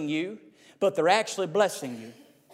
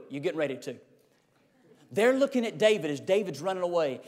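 ordinary delivery

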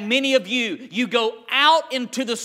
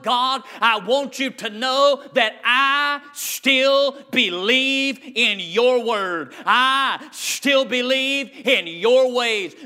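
slow earnest prayer; slower than usual. Acted. David's defiant prayer voiced.

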